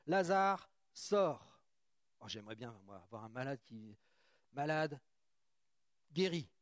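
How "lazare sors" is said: calling out loudly, voice raised; loud cry. A narrator's reading. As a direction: shout the command into the tomb. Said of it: "il cria d'une voix forte". (cri d'une voix forte)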